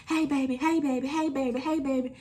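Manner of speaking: lightly voiced pushy neighbour; sugary neighbour. Neighbour calling her sweetly.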